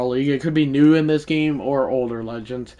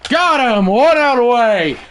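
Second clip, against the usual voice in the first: excited shout; 10 semitones higher, 8 dB louder than usual. Real, not acted. Excited outburst at a game play.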